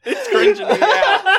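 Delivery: very high, laughing through the words; laughing squeak. Real, not acted. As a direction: squeal it out while cracking up.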